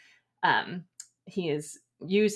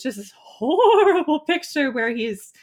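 dramatic emphasis; 10 semitones higher, 8 dB louder than usual. Real, not acted. Excited spooky description.

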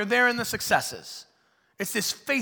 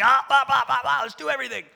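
higher, louder, fast babble; scatterbrained eagerness; 7 semitones higher, 8 dB louder than usual. Retold or imitated. Twenty-six year old pastor rushing every idea, babbling. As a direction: babble like an overexcited kid. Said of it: "I was like".

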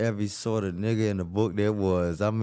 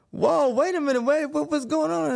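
much higher, alarmed and pleading; panicked protest. Retold or imitated. Left behind as the bus closes its doors, panicked high plea.

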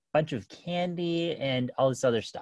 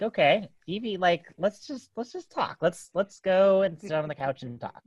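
put-on higher, soothing coax to a child; gentle coaxing; 6 semitones higher than usual. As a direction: lure her to the couch kindly. Coaxing wild daughter to calm down.

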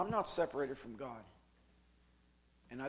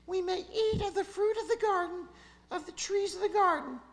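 very high, falsetto Eve; preacher voicing Eve to the serpent in falsetto; Eve's voice; 8 dB louder than usual. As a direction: read Eve's reply in a squeaky falsetto.

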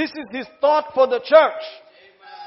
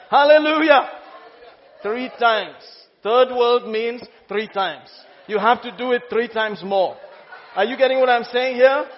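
usual speaking voice

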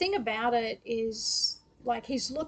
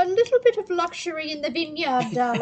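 posh drawling lady of leisure; lah-di-dah upper-crust voice; lockjaw accent; 4 semitones higher, 5 dB louder than usual. Pretend. Posh lady sipping wine quote.

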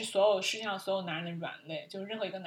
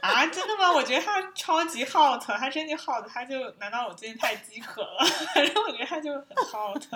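high, loud, giggling insistence; laughing protest; 6 semitones higher, 8 dB louder than usual. Real, not acted. Host tells her co-host she finds Evan hot, laughing. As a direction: laugh through it, half embarrassed.

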